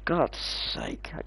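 whispered under the breath, frustrated; muttered whisper. Real, not acted. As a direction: hiss it out in annoyance. Annoyed after losing the kill.

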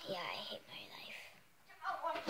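slow whispered aside. Acted. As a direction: whisper it slowly, mock despair.

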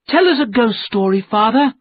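put-on high, loud pleading child; eager young cry; crying out eagerly. A narrator's reading. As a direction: beg Father loudly for a story. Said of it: "someone cried".

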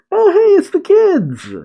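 very high-pitched delighted squeal; gleeful falsetto-high exclamation; excited greeting. Real, not acted. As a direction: burst out on spotting familiar faces. Delighted at spotting the kids.

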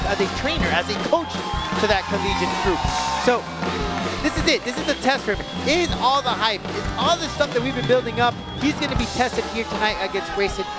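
usual speaking voice

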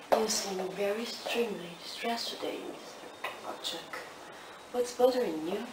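ordinary talk

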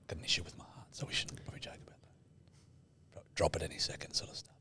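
hushed, breathy whisper about the heart; whispering that it shouldn't be joked about. Real, not acted. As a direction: drop to a whisper as if sharing a secret.

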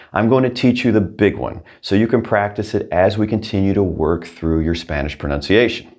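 usual speaking voice

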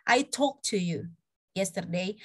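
"talked" said as "talk", running slowly into "to"; present-tense sound. Shown as a demonstration. Teacher testing students' ears, "talked" without its past ending. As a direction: drop the extra t of "talked". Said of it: "Ada yang beda nggak?".

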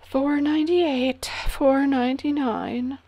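ordinary delivery

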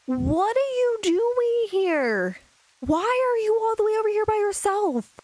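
surprised. Real, not acted. Finds Will off alone.

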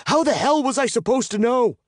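high, loud, furious shout. A narrator's reading. Captive defending himself to his captor, shouting. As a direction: yell it in exasperated outrage. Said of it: "I shout".

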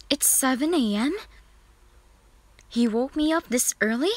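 indignant at being woken, ending each question high; indignant disbelief. A narrator's reading. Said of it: "you decided to glare at him".

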